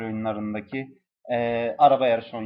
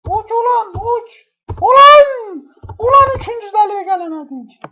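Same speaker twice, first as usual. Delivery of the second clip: shrieking very high and loud; high-pitched yelling; 11 dB louder, slower than usual. Real, not acted. Gamer rages at his dying bird, shrieking high. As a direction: scream at the bird in falsetto frustration.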